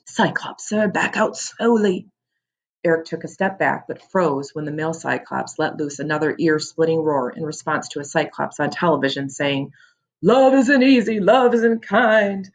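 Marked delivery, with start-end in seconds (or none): 0.1-2.1 hushed, trembling warning; shaky whisper. Acted. Jam warns Eric in his ear, shaky whisper. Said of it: "Jam whispered into his ear, voice shaking". 10.2-12.5 high, loud, slow dramatic wail; TV lament. Acted. TV Cyclops laments love on talk show, dramatic wail.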